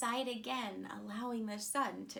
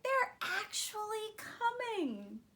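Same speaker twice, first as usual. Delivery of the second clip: excited moon; 11 semitones higher, 4 dB louder than usual. A narrator's reading. The moon seeing the astronauts approach, breathless and thrilled. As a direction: breathe it out in awe, way up high. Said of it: "the moon said".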